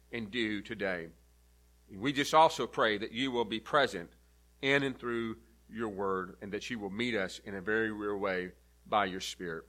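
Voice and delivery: a pastor's hushed opening prayer. Acted. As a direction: pray it quietly and reverently. Opening prayer before the sermon.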